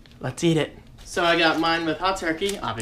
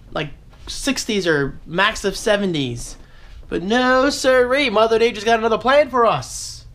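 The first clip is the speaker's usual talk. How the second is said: mock outrage; 7 semitones higher than usual. Mock outrage at hot weather.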